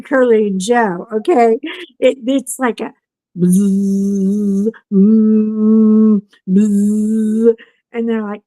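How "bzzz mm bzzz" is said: buzzing noise like a vibrating box; mimicked electric buzz; imitated buzzing.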